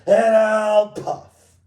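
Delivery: loud, slow, drawn-out threat; angry old wolf. Acted. Wolf threatening the pigs outside, angry old wolf. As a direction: bellow each threat in big separate bursts. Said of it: "cried the angry old wolf".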